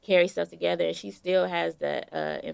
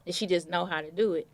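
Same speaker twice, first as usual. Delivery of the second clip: ordinary talk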